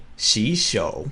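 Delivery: "洗手" said slowly, clear tones; Mandarin tones. Shown as a demonstration. Teacher demos "洗手" for learners, slow and clear. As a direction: sound out "洗手" slowly. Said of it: "So if I say".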